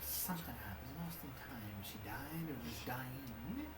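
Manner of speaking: soft storytelling voice. Acted. GM narrates in character.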